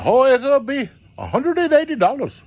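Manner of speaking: high mock Ukrainian-accented dancer; foreign accent. Dancer naming her price, high mock Ukrainian accent. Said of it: "she didn't speak real good English".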